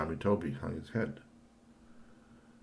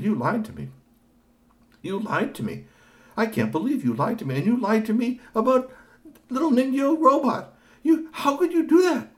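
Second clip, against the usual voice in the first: high, louder reproach building; hurt scolding; 10 dB louder than usual. Acted. Mother scolds the boy who lied to her, rising reproach. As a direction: scold him for lying. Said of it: "kind of miffed at him".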